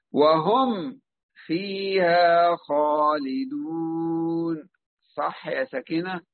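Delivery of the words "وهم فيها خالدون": "وهم فيها خالدون" very slow, a stop after "وهم"; slow teaching recitation with a stop on the ميم; demonstrated recitation. Shown as a demonstration. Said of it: "اسمعوا جميعًا". (تلاوة بطيئة مع سكت على الميم)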